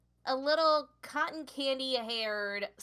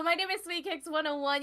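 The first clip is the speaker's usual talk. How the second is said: normal talking voice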